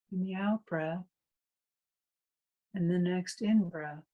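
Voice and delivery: calm, unhurried meditation guide with long pauses; guided meditation. Real, not acted. Meditation teacher leading a class into breath awareness, calm guided meditation. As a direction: guide listeners through the breath in spaced-out, even phrases.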